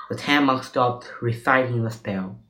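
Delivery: ordinary delivery, nothing marked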